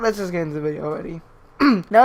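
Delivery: ordinary delivery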